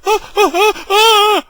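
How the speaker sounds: short high burst of laughter; high mocking laugh. Real, not acted. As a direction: laugh them off. Laughs at whiners' excuse.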